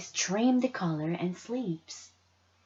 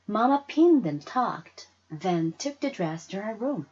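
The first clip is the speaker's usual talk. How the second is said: ordinary delivery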